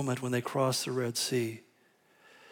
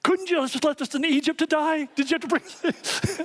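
much higher, squeaky mock complaint; mock whiny Moses; 10 semitones higher than usual. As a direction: play him griping to God in a comic high voice. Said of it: "calmly turns to the Lord and says".

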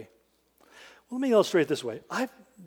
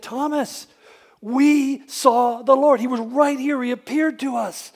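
higher, louder, slow insistence; urgent telling; 8 semitones higher, 10 dB louder, slower than usual. Retold or imitated. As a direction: plead with Thomas to believe. Disciples urge Thomas.

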